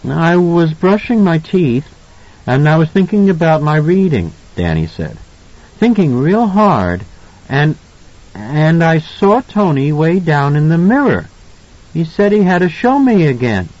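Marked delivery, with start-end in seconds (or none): 0.1-4.3 put-on high child's voice, recounting; earnest little boy. A narrator's reading. Son explaining to his parents, little boy voice. 5.8-13.7 put-on high child's voice, recounting; earnest little boy. A narrator's reading. Son explaining to his parents, little boy voice.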